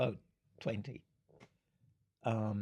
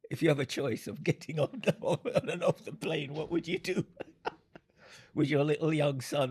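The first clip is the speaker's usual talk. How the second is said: laughing through the question, pitched higher; 4 semitones higher, 6 dB louder than usual. Real, not acted. Speaker asks the nurse about the pilot, laughing. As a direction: ask it while cracking up.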